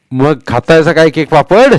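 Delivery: mock the boys with a laugh in it; teasing old man.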